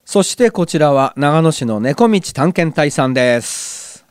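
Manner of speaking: ordinary talk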